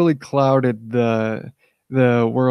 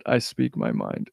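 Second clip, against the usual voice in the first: dropped way lower and softer; low mock-solemn; 7 dB quieter than usual. Shown as a demonstration. Streamer repeating his motto to viewers, low and soft. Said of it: "And as I said".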